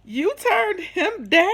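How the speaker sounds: high, loud, drawn-out disbelief; incredulous, stretched-out question; surprised. Acted. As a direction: ask slowly in astonishment, pitch shooting up. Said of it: "I was surprised".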